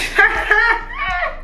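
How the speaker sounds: high shrieking burst of laughter; squealing laugh at the memory. Real, not acted. Laughing at own story.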